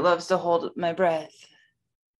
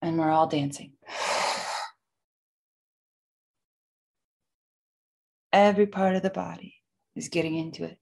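guided breathwork. Acted. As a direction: lead the breathing gently. Guides a box breathing session.

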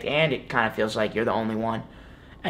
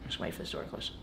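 very soft, barely voiced aside; hushed aside; 9 semitones lower, 18 dB quieter, faster than usual. Real, not acted. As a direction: drop right down to a near-silent murmur. Aside while a door closes.

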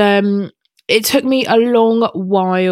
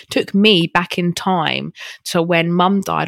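ordinary talk

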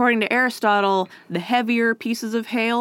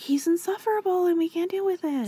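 mock exasperated teachers; 10 semitones higher, 4 dB quieter, faster than usual. Teachers quoted, high fast.

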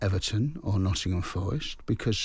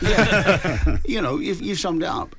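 high laughing yeah; 9 semitones higher, 6 dB louder than usual. Real, not acted. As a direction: laugh as you repeat it. Laughs at the brush-off.

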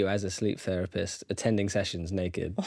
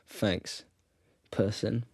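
flatline; 2 semitones lower, 7 dB quieter, much slower than usual. Retold or imitated. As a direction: drop all energy, totally flat. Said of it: "You're like".

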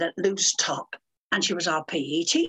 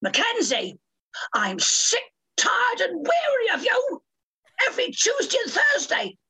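teacher's scolding; 13 semitones higher than usual. Retold or imitated. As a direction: whine it like a fed-up PE teacher. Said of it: "she'd put her hand on her hip and she'd say".

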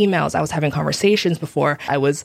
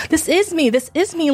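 high-pitched, desperate insistence; crazy person insisting; 11 semitones higher than usual. Retold or imitated. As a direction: plead it like someone nobody believes. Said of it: "sound like the crazy person saying".

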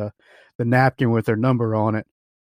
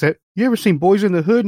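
high, louder retold remonstration; acted protest; 8 semitones higher, 5 dB louder than usual. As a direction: imitate a friend protesting, pitched up. Voicing Mike's protest about the gun.

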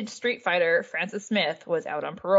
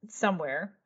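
normal talking voice

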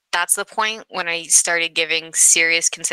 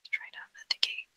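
muttered whisper; 14 dB quieter, faster than usual. Real, not acted. Muttering to herself at computer.

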